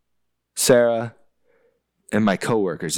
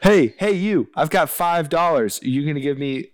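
loud, higher hectoring haggle; mock haggler; 5 semitones higher, 4 dB louder than usual. Co-host acts out negotiating an exchange rate, as a pushy haggler. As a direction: accost them like a pushy bargainer.

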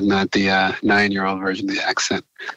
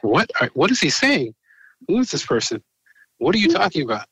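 higher-pitched mock bafflement; baffled questioning; 9 semitones higher than usual. Peers puzzled by the newcomer's outdated slang, mock baffled. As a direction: imitate puzzled listeners, pitch jumping up. Acts out baffled listeners' reactions.

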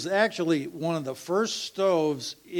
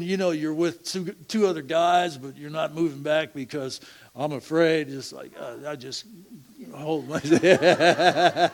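ordinary talk, nothing marked

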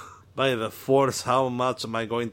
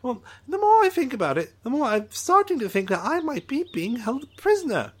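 excited alien Trixel; 9 semitones higher, faster than usual. Acted. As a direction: greet him like an overeager little alien. Drawl rambles on.

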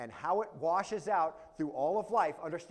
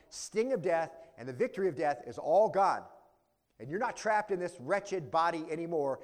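ordinary delivery

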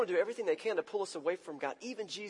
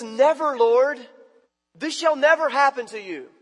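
loud, higher, forceful refusal; loudmouthed rebuke; 8 semitones higher, 10 dB louder, slower than usual. Acted. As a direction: bark it like a brash friend. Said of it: "this guy is a loudmouth".

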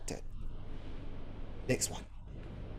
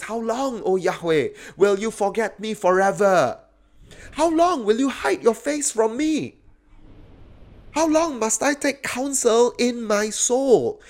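raised, insistent lament; anguished cry; 9 semitones higher, 3 dB louder than usual. Acted. Preacher voicing the psalmist's complaint to God, anguished cry. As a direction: cry out to God, high-pitched and pleading. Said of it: "Do you have that as your cry?".

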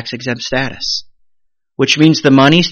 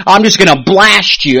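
higher, louder mock threat; mock angry outburst; 6 semitones higher, 6 dB louder than usual. Someone choosing to blast the other in a conflict, mock angry. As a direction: punch it like someone about to lash out.

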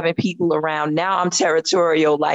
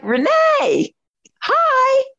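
high, loud fan greeting; eager call-out; 3 dB louder, much slower than usual. Retold or imitated. Fan calls out to the singer as he leaves, loud and high. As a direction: call his name up high across the room. Calling to star before he leaves.

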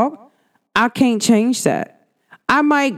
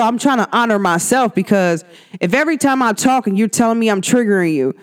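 usual speaking voice

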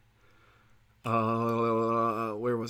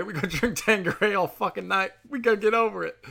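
much higher, squeaky mock party voice; 8 semitones higher than usual. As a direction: crank the pitch way up, carefree. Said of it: "what is he, Tanqueray".

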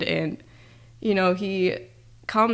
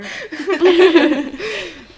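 loud high laugh; 11 semitones higher, 9 dB louder than usual. Real, not acted. Laughing at the joke.

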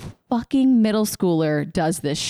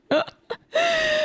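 burst of laughter. Real, not acted. Co-host laughing at the band plot.

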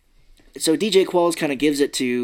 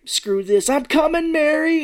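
much higher, louder rallying call; heroic cry; 4 dB louder, slower than usual. Retold or imitated. Retelling the hero running to the hole for Mary, mock rallying shout. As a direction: burst out like a movie hero charging in.

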